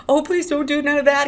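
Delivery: high, loud tearful plea; frightened begging. A narrator's reading. Lenny crying with fright, desperate begging. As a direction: beg as if about to cry. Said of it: "he begged".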